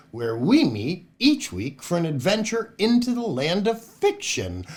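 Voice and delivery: plain reading voice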